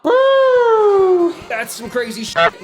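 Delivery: high loud whoop of delight; excited whoop. Real, not acted. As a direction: cheer like the show just got good. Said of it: "excited whoop".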